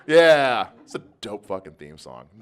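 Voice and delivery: usual speaking voice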